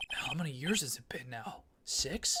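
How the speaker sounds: whispered, soft and unvoiced; hushed question. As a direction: ask it under the breath. Quiet reunion with old mentor.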